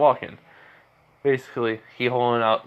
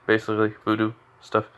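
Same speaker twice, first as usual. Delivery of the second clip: ordinary delivery, nothing marked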